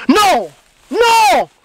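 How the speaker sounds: loud, high furious no; angry shout. Acted. Boyfriend shouting no at his girlfriend, furious. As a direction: yell it out in anger.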